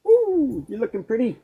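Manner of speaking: high-pitched cooing praise; admiring coo. Real, not acted. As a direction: coo sweetly to a pet, voice lifted. Admiring the dog being groomed.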